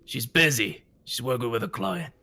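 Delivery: short, dismissive brush-off; lightly voiced annoyed boss; curt Boris. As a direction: boss waving off the question.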